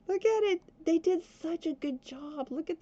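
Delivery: high, gushing praise; excited. Real, not acted. Player praising the mod makers' work, excitedly gushing. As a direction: rave about it, squealing a little. Raving over fixed mod.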